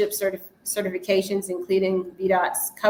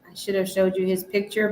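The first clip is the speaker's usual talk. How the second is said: ordinary talk, nothing marked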